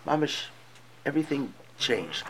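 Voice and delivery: ordinary talk, nothing marked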